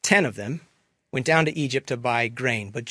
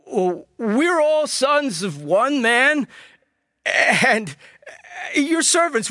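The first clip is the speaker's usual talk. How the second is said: higher, stammering, near tears; frightened pleading; 6 semitones higher, slower than usual. A narrator's reading. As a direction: beg for your lives, voice cracking. Frightened brothers plead innocence.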